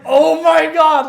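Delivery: loud, high, slow Oh my God; exasperated panic. Retold or imitated. Mechanic reacts to his calm coworker, loud high exasperation. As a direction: stretch it out in disbelief.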